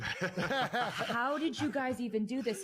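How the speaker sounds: high, slow, laughing disbelief; amazed reaction. Retold or imitated. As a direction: act out being astounded, stretching the question. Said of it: "I'd just be like".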